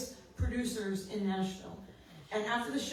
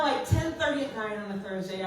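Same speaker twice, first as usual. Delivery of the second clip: usual speaking voice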